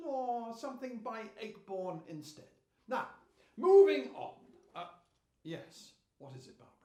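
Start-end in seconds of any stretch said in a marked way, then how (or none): 2.9-4.3 brisk moving on. Acted. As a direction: push the meeting on, loudly. Chair firmly closing off discussion.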